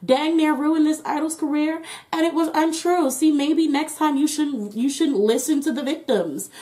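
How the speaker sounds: smug liar. Retold or imitated.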